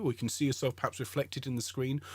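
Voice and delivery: normal talking voice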